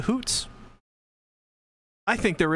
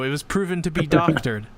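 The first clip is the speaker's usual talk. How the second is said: ordinary delivery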